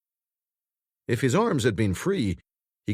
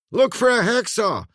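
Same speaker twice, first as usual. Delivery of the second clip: higher, louder urgent shout; 12 semitones higher, 5 dB louder than usual. A narrator's reading. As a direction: yell the order over the noise. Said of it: "Dermot shouted".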